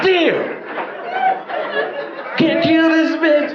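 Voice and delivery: high, slow whiny letdown; mock disappointment. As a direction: sulk like a kid told no. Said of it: "I said".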